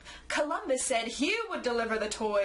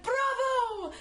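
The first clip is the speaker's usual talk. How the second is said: cheer; 11 semitones higher than usual. Acted. Columbus cheering the narrator's plan.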